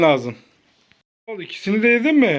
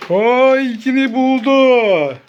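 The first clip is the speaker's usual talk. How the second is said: drawn-out, louder exclamation; delighted cheer; 2 semitones higher, 4 dB louder, much slower than usual. Real, not acted. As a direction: celebrate the find with slow, stretched words. Cheering the child's find.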